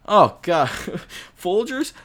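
incredulous high rise; disgusted question. Real, not acted. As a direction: scoff at the name. Disgusted at the coffee brand.

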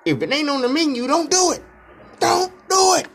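mounting don't do it; building scold. Real, not acted. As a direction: scold them harder every time. Scolding customers, building repeats.

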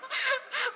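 real giggle, high and airy; nervous high laugh. Real, not acted. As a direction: laugh off the fright. Nervous laugh at own fear.

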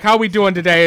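big hearty hello; Tom Atkins impression. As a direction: greet the group with gusto. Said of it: "inflection is so spot on for him".

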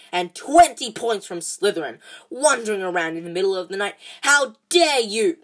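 loud, furious telling-off; a strict teacher laying down the law; stern scolding. A narrator's reading. McGonagall scolding Malfoy out of bed, loud and angry.

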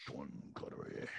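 hushed attempt at a Scottish film-star accent; Sean Connery. Co-host has a go at the actor he was compared to, half-hearted Scottish accent. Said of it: "I can't do that accent".

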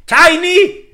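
loud, high call of a name. Retold or imitated. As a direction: yell the name across the room. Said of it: "en Venezuela era como que".

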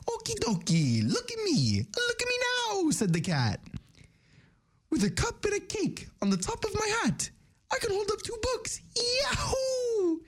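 very high Italian plumber voice; Mario voice. Acted. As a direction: squeak it like Mario. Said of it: "Now we got Mario and Luigi".

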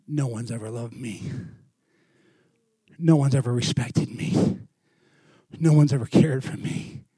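low, hushed retelling of his hurt; subdued confession. Retold or imitated. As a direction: confide it quietly, line by line. Gang member confides he was never loved.

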